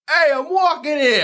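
high, brash New York accent. Shown as a demonstration. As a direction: yelp it like an indignant New Yorker. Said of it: "The New York accent".

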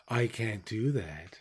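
slow, quiet, calm machine voice; imitating a calm ship computer refusing, slow and hushed; movie computer quote. Quoting Space Odyssey to a co-host, slow hushed computer voice. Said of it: "the Space Odyssey".

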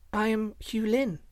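put-on high, slow little-girl voice; child, simply. A narrator's reading. Slave girl tells the man her name, simple child voice. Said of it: "said the child simply".

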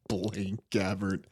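low, soft laugh through the name; pundit chuckling in disbelief. Real, not acted. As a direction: laugh it off in defeat. Laughing defeated repeat of name.

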